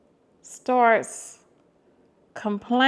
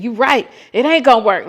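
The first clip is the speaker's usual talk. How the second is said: louder, fast, snippy mock retort; funky attitude; 3 semitones higher, 8 dB louder, faster than usual. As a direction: throw it back with attitude. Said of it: "he really had this funky attitude".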